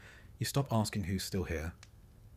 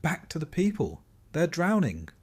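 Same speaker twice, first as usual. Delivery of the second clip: high, loud plea from the crowd; echoed cry; 8 semitones higher, 6 dB louder than usual. A narrator's reading. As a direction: take up the cry, pitched high. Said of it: "Others echo him".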